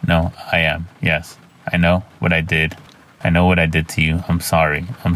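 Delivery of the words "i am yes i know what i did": plain reading voice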